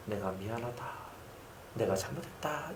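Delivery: a faint dying father saying sorry; frail father's apology. Retold or imitated. Frail father apologizing to his pastor son, very soft. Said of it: "아버지가 딱 저한테".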